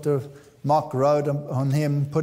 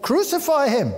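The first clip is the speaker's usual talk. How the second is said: crowd's cry; 9 semitones higher than usual. Retold or imitated. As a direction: cry it out high like an angry mob. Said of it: "And they said".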